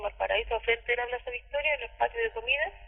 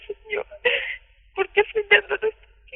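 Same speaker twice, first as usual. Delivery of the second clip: tearful prayer; 10 semitones higher, 4 dB louder than usual. Retold or imitated. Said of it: "Y le decía".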